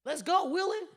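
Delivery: pitched high, rowdy call; high hammed-up shout; mock yell. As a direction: holler like a drunk shooter. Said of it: "And then".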